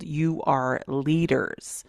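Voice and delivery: ordinary talk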